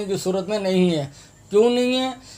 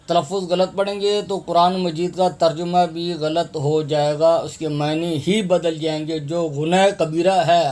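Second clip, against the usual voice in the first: ordinary talk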